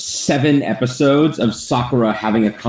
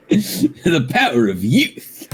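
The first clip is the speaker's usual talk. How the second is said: slow, laughing, slightly higher hero boast; Guy catchphrase; 6 semitones higher, much slower than usual. Acted. Playing Guy for his friends, slow and laughing. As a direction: stretch out the proud slogan while laughing.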